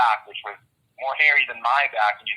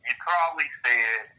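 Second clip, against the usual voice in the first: ordinary delivery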